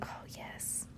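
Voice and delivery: soft breathy excited whisper. Real, not acted. Telling viewers about cupcakes in a whisper.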